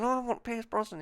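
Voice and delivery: soft, quick, high whimper; whiny murmur. Retold or imitated. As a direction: sulk it under your breath in the cinema. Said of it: "And I kept going".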